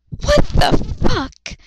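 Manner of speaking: high, loud, slow disbelief; outraged. Real, not acted. Reader reacting to the replacement, high outraged cry. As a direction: shriek it in shock.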